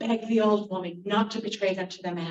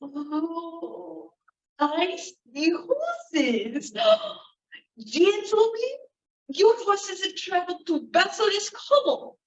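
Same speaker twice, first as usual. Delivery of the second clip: put-on old fortune teller; 9 semitones higher, slower than usual. Old woman telling the merchants, rising to a shout. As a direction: gasp and proclaim the vision.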